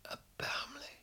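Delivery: whispered, breaking into a sob; sobbing whisper. Acted. After screaming at the friend, a broken whispered sob. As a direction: let the word crumble into a sobbing whisper.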